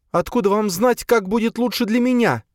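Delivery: put-on high, indignant young woman; indignant Sofia. A narrator's reading. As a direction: bristle with offence, pitched high. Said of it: "возмутилась она". (возмущённо, высоким голосом)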